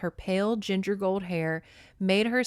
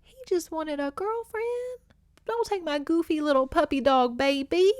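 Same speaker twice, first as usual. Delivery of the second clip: mock pleading; 7 semitones higher than usual. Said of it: "I said".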